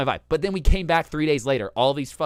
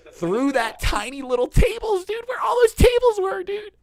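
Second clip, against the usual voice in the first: much higher, louder, near-squealing retelling; excited squeal; 8 dB louder than usual. Retold or imitated. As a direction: squeal it like it's unbelievable. Squeezing bags between restaurant tables.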